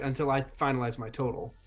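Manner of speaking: ordinary talk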